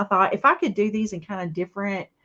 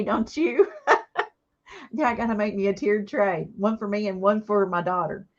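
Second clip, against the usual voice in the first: ordinary talk, nothing marked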